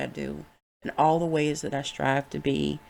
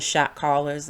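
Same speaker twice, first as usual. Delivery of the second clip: ordinary talk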